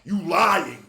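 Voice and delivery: usual speaking voice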